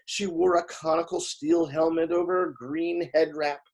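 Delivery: plain reading voice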